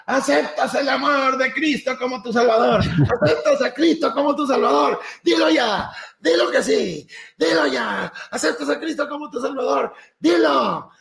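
high shouted questions with laughter leaking through; mock preacher shouting. Preachers yelling at Martín to accept Christ, laughing. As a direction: pressure him to say yes, hammed-up. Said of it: "me empezaron a gritar".

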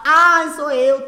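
very slow, high, loud camp reply; mock owner. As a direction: announce yourself with camp flair. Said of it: "Luiz Pedro lá".

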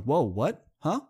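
disbelieving double take; stunned surprise. Retold or imitated. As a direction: caught off guard, a startled "whoa". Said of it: "I'm like".

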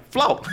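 high sudden smack noise; imitated impact. Passenger telling how the mirror hit the cyclist's hand, imitated impact. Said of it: "a mão do cara fez assim".

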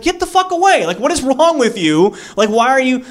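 yelled way up high; startled yell. Retold or imitated. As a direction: scream it at him, outraged. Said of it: "and he's like".

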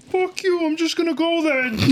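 very high mocking imitation; Mister Boo. Classmate imitating Mister Boo to his face, high mock voice. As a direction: put on a silly high voice. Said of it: "do Mister Booth's voice right to him".

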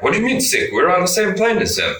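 mock the guards' outraged surprise; incredulous guard. Russian guards argue about doctor.

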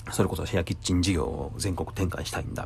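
ordinary delivery

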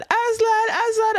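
high, loud calls for a lost cat; high-pitched searching call. Retold or imitated. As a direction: re-enact hollering the cat's name.

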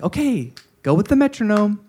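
high, sing-song music teacher. Acted. Teacher clapping time for music class, high teacher voice. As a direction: pitch it well up like an elementary teacher. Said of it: "the teacher's, like, clapping".

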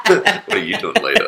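playful flirty pickup line. Pretend. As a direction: toss it out like a cheeky come-on.

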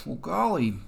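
gee-whiz big brother voice; Wally voice. Pretend. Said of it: "Wally'd be like".

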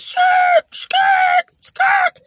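a high shouted imitation of screeching tires. Cars pulling up fast, a high shouted tire screech.

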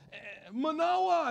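drawn-out call across the house; excited wife calling her husband. Acted.

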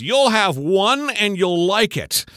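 stern father. Retold or imitated. Dad refusing son bacon, slow stern order. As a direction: lay down the law slowly.

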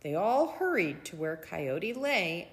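plain reading voice

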